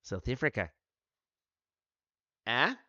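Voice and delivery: a few words in a South African accent. Shown as a demonstration. Showing chat a South African accent, briefly. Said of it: "I can do my South African".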